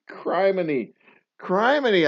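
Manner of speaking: high, loud mock-shocked "Criminy."; laughing criminy. Real, not acted. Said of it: "I said criminy out loud".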